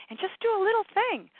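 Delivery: very high, loud, eager voice; gushing enthusiasm. Acted. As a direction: squeal it with acted delight. Acted keen listener after class.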